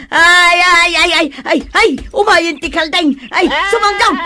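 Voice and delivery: high, loud frantic yelps and calls for help; panicked cries for help. Acted. Tangled in the rope, shouting for help as the goat runs. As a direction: scream in pain and panic as the goat drags you.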